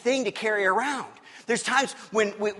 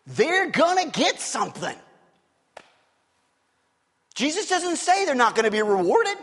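rising preaching fervor; 7 semitones higher than usual. Real, not acted. Preacher builds to climax.

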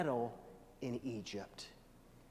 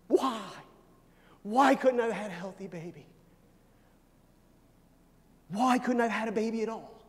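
high, pleading voice, imitating distraught parents; acted anguished cry; 7 semitones higher, 9 dB louder than usual. Preacher voices crying parents asking God why, acted anguish. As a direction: cry out, then plead on a raised pitch. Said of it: "they're crying, and they're saying".

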